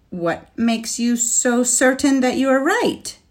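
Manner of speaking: high, pressing question; challenging. Acted. Knight challenges dragon.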